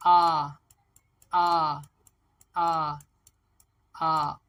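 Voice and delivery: आ as a loud, long "aah", repeated separately; vowel sound drill. Shown as a demonstration. Teacher shows how to practise vowels aloud, loud drilled आ. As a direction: belt out each आ as an open vowel. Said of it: "sounds के साथ आपने अपनी practice करनी है".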